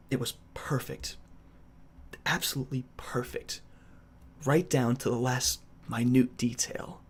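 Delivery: low, soft, deliberate menace; Homelander. Acted. Trying a requested villain impression, low soft measured delivery. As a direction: go quiet and deep with long pauses. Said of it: "Do Homelander?".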